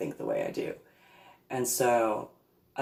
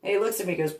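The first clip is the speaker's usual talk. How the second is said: ordinary delivery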